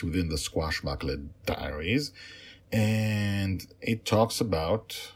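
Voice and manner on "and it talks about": plain reading voice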